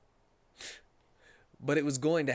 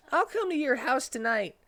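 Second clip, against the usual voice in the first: higher, eager put-on voice; Tails character voice; 12 semitones higher, 4 dB louder than usual. Tails accepting Cream's invite, higher eager voice. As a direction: voice Tails trying to sound cool.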